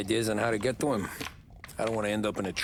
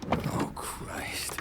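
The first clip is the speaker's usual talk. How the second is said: ordinary delivery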